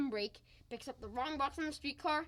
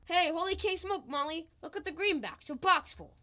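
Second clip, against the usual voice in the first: loud, raised, thrilled exclaiming; excited amazement; 4 semitones higher, 7 dB louder than usual. Acted. Husband showing wife the cash he found, excited amazement. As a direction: crow over a lucky find. Finds box full of money.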